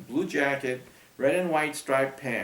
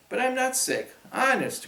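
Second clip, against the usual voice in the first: very high, pleading protest; high pleading girl; 12 semitones higher than usual. Acted. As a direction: plead like you might cry. Grace protesting near tears.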